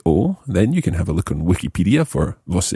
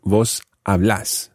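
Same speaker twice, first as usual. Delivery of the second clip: slow vos hablás, stress on LÁS; stressed second syllable; slower than usual. Shown as a demonstration. Teacher demonstrating the vos form, hablás stressed on second syllable. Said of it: "with a stressed second syllable".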